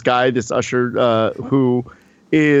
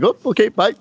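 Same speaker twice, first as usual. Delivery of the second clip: high, quick mock-polite goodbye; retold sheepish retreat; 8 semitones higher than usual. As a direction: back off sheepishly, pitch jumping up.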